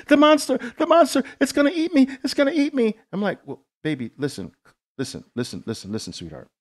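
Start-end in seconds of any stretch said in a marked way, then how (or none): 0.1-2.9 put-on high, fast panicked little-girl voice; terrified child. Father imitating his daughter afraid of a monster, panicked. 3.5-6.5 softer, lower calming voice; soothing dad. Retold or imitated. Father calming his scared little daughter, tender.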